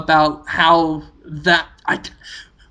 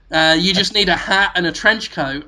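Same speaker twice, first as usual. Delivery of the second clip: ordinary delivery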